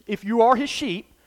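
usual speaking voice

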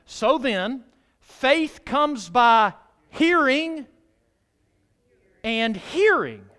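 slow, loud, drawn-out "hearing, and hearing"; emphatic scripture quote. Acted. Preacher stresses "hearing" to his congregation, slow and loud. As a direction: lean hard on "hearing" both times.

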